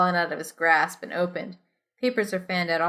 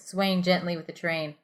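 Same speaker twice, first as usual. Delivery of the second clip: ordinary talk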